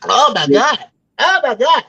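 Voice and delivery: high, loud "oh my God"; shocked delight. Real, not acted. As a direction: gasp it out like the burn just landed. Host reacting to the burn.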